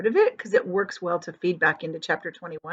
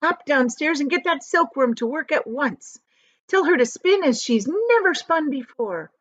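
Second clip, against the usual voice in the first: higher, urgent commands; shouting orders; 6 semitones higher, 3 dB louder than usual. A narrator's reading. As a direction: rush them along like a captain. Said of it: "James shouted".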